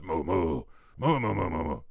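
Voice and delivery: low moos chattering along; a run of moos like murmured explanation; conversing cows. Acted. Cow fills another in on conspiracies, chattering low moos. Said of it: "And they're like".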